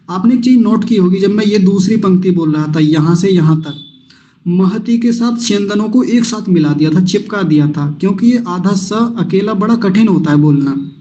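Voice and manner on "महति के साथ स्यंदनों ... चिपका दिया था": ordinary talk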